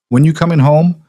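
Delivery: put-on high, eager child voice; little girl. Little girl shows her dad her drawing, high and eager. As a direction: show off a drawing like a small kid.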